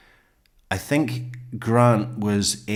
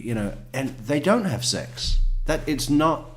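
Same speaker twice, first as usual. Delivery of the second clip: ordinary delivery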